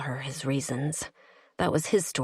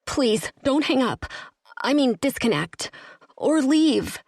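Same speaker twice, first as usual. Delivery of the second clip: panicked; 8 semitones higher than usual. Acted. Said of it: "and I panicked".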